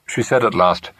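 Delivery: ordinary delivery, nothing marked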